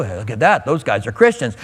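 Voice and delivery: whisper-like comment from the next table; lightly voiced restaurant bystander; bystander mutter. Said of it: "People around are looking at you".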